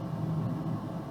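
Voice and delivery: quiet airy laugh; breathy chuckle. Real, not acted. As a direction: snicker under your breath. Laughing while leaving.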